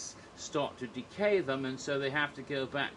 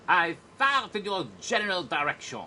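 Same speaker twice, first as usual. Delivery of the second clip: much higher and louder, a mocking French insult; French knight taunting the English from the battlements; French taunter; 6 semitones higher, 6 dB louder than usual. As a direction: hurl the insult from the castle wall. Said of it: "kniggets outside, taunting you".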